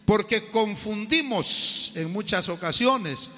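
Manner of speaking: loud, slow, emphatic sermon delivery; fiery preaching. Acted. Preacher rebuking those who boast of knowing God, loud and emphatic. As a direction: hammer each point home to the congregation.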